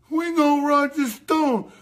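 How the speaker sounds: high, slow mockery; high slow mock of accusers. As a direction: sneer it like the critics.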